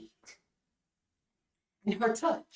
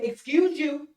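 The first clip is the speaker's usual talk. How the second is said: sassy retort; 3 semitones higher than usual. Retold or imitated. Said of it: "go to the extent and say".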